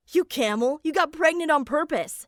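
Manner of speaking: storybook outburst at a culprit; screaming in outrage. A narrator's reading. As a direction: act an angry yell, only partly shouted.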